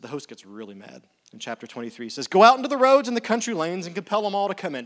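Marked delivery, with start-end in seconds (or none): 2.3-4.8 loud command. Acted. Host orders servant, scripture read.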